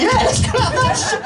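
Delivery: very high, loud, laughing mock cheer. Real, not acted. Comedian sarcastically demanding applause for a heckle, very high and loud.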